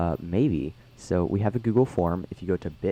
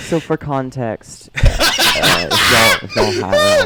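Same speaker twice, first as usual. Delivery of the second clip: loud laughing through the words, slow start; flustered laughter, much louder; 6 semitones higher, 13 dB louder, much slower than usual. Real, not acted. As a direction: laugh while owning up. Laughing while explaining the song.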